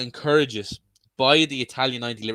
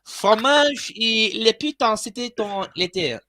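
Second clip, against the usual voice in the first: hammed-up French accent, high pitched; 8 semitones higher, slower than usual. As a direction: ham up a French waiter voice. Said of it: "I have a better French accent than him".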